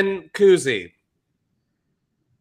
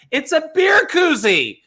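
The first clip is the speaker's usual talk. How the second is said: loud, slow, disbelieving outburst; incredulous shout; 10 semitones higher, 5 dB louder than usual. Real, not acted. Cohost shouting in disbelief at the koozie ad, loud and slow. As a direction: yell it out in disbelief.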